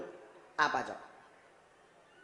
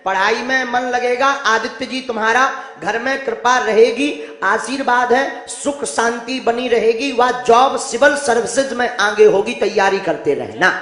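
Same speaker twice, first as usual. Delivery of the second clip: solemn proclamation; 2 semitones higher, 7 dB louder than usual. Acted. Guru reads out the blessing.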